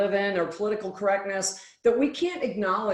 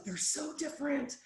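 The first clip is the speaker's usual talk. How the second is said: weary despair; 7 semitones higher, 10 dB quieter than usual. Retold or imitated. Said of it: "And he's like".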